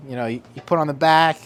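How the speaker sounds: usual speaking voice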